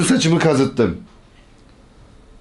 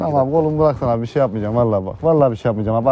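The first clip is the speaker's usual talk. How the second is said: fast, lowered pleading promises; hurried pleading; 4 semitones lower, much faster than usual. Pretend. Fake patient begs the minder to release his arm, hurried pleading. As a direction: rattle off assurances to get free.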